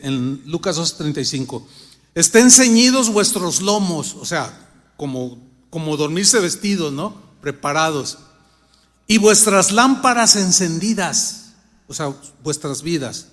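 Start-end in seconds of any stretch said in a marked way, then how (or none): 2.1-4.1 louder, solemn Bible verse; scripture reading. Acted. Preacher reading Luke to the congregation, solemn. 9.1-11.5 louder, solemn Bible verse; scripture reading. Acted. Preacher reading Luke to the congregation, solemn.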